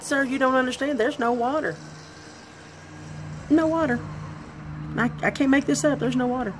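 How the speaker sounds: high exasperated protest. Retold or imitated. Protesting to the lifeguard there's no water, exasperated.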